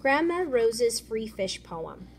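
ordinary delivery, nothing marked

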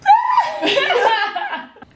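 shrieking laugh; loud burst of laughter. Real, not acted.

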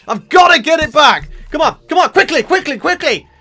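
high, loud, urgent rush; frantic urging. Real, not acted. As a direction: hurry them on in a panic. Urging himself to recover gold.

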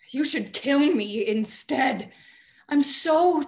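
loud, high, desperate cry; raw, overwrought wail of tiredness; anguished outburst. Acted. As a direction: plead in exhausted anguish, rising. Breaking down in despair.

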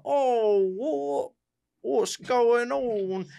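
high, drawn-out silly voice; wrong Tsar voice. Acted. Baffled Tsar answering the soldiers, high silly mock voice. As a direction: stretch it out in a high, goofy voice. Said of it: "'Cause he don't talk like that".

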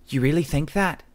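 high, challenging question; bluffing her attacker with a show of confidence; defiant demand. A narrator's reading. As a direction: fake confidence to make him hesitate. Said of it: "she demanded".

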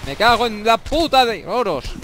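swearing in panic at the grenade; exasperated curse. Real, not acted.

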